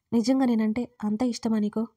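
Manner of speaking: ordinary delivery, nothing marked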